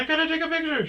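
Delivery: high, excited reporter imitation; news reporter voice. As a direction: pitch it way up like a starstruck woman. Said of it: "She's like".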